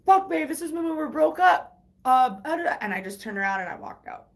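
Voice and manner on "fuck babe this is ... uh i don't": higher, louder flustered excuse; scrambling to explain himself; hammed-up caught-out boyfriend; imitating the boyfriend. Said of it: "he was like".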